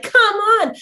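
high, bossy pet voice; voicing the dog. Dog herds the family back together, in a high bossy voice. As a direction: herd them back together. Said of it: "be like".

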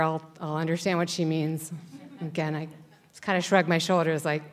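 ordinary delivery, nothing marked

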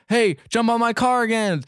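high, drawn-out retold call; mock calling out. Vin Diesel calls to the stranded jumper, mock shout. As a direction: call it across, high and slow.

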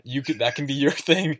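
teasing while laughing. Real, not acted. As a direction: giggle through it.

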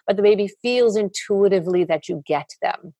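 normal talking voice

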